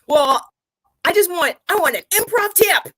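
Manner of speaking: mock the impatient improviser who just wants tips; whiny improviser. Co-host mocks straight improvisers demanding a tip, high and whiny. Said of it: "'cause that's how improvisers sound".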